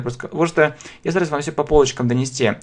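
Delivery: usual speaking voice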